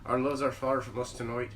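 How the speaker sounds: put-on low, soft Irish accent poetry reading. Reciting Irish poetry to friends, Irish accent. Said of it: "doing it in a fucking Irish accent".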